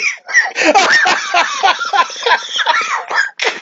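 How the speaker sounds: long, high belly laugh; uncontrolled real cackling; helpless laughter. Real, not acted. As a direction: laugh until the breath runs out. Laughs at suggested title.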